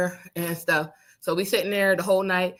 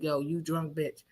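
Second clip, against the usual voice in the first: low mocking friend; 4 semitones lower, 9 dB quieter, slower than usual. Friend voiced, low and slow.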